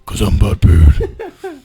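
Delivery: slow, loud, laughing superhero impression; hammed-up hero growl while laughing; mock Batman voice. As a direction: drag it out like a joke Batman.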